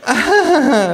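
high artificial hostess laugh; fake laugh. A narrator's reading. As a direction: laugh falsely, too high. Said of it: "lacht die Frau künstlich". (hohes, künstliches Lachen)

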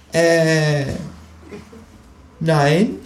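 high, hesitant denial; stall, then lie; high kangaroo voice, caught out; fibbing kangaroo. A narrator's reading.

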